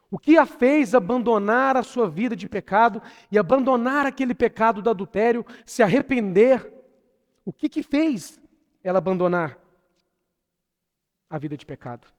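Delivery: loud, high-pitched rhetorical questioning; impassioned preaching. Real, not acted. Preacher asks church what made her repent, impassioned. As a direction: press the question on the congregation with force.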